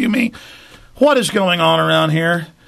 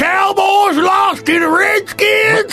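hillbilly; 14 semitones higher, slower than usual. Acted. Hillbilly football fan stunned by the Cowboys' loss, hollering. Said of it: "I love doing hillbillies".

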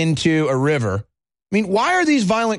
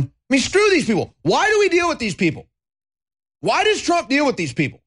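high-pitched angry outburst; exasperated rant; 7 semitones higher than usual. Real, not acted. Host fed up with the correspondents association, exasperated rant. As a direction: demand answers in fed-up exasperation. Ranting about the press association.

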